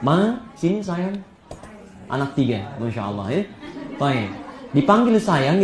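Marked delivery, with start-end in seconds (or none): 0.0-1.2 slow, soft endearment; sweet, affectionate call. Acted. Husband calling his wife after salty noodles, sweetly. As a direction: call your wife over sweetly, drawing it out. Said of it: "kalimat yang manis untuk memanggil istrinya".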